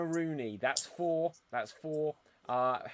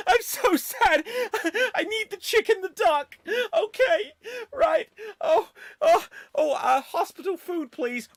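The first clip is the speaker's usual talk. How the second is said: high laughing wail; sob-laugh through it, very high; Joey with leprosy; 11 dB louder than usual. Acted. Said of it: "Joey Tribbiani suffering from leprosy".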